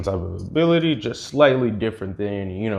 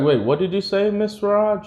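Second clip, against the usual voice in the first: high-pitched retold double take; surprised question; 10 semitones higher than usual. Kid questions what Miss Raj said, surprised question. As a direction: ask it high, as if caught off guard.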